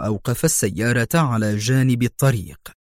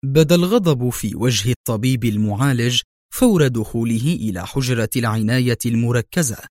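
ordinary delivery, nothing marked

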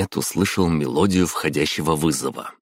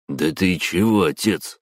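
plain reading voice

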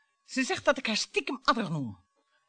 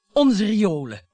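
rising outburst; 7 dB louder, slower than usual. Acted. Wife's bitter outburst about sewers.

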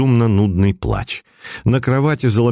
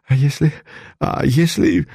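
stammering; 4 semitones higher than usual. A narrator's reading. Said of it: "заикнулся он".

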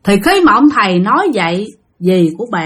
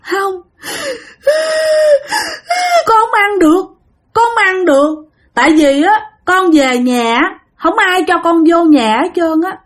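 higher, tearful refusal; crying ghost; 9 semitones higher than usual. Retold or imitated. Ghost telling his aunt he can't come home, higher crying voice. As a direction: answer through tears, worn out. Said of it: "Thì người đó trả lời vậy nè: cry".

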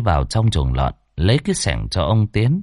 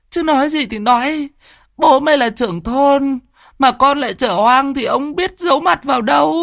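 higher, tearful pleading mother; mother coaxing a pregnant daughter, high and near tears. Acted. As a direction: beg your daughter to confess, voice pitched up. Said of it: "Bà Xuân mếu máo".

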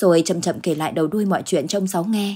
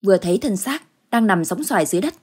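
ordinary delivery

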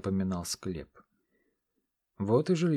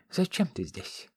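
put-on old crone voice; 6 semitones higher, slower than usual. A narrator's reading. Fortune teller asks.

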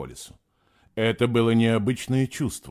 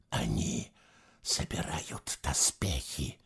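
low, soft, slow whisper; 10 semitones lower, 8 dB quieter, slower than usual. Acted. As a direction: whisper it in dread. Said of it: "тихо прошептал он".

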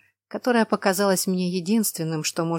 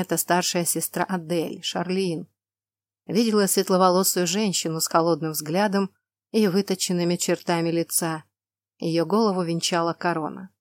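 ordinary delivery

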